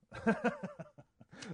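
high burst of laughter; big surprised laugh; loud laugh. Real, not acted. Laughs at own misspeak.

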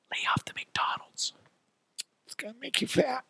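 hushed, whispered warning; soft mocking whisper, squeaking up at the end. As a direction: whisper it like unwanted advice, mockingly. Mocking replay of the advice.

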